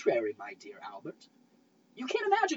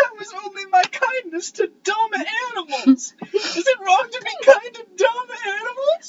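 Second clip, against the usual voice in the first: louder, higher, laughing into tears; laughing protest; 10 semitones higher, 9 dB louder than usual. Acted. Woman defending her tears over animals, louder laughing near cry. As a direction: laugh it off then break into a cry.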